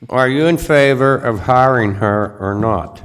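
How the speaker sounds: loud, firm repeat. Real, not acted. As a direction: repeat it loudly so he hears. Repeating question to remote member.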